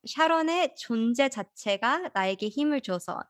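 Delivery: 샤론의 존재 자체가 나에게 힘을 줘서 said fast in native Korean; Korean quote. Retold or imitated. Quoting a former student's message, the line read fast in Korean. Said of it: "She said this in Korean".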